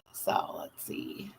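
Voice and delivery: whispered, slow, to herself; barely-voiced murmur while scanning chat; whisper to self. Real, not acted. Muttering to herself reading chat.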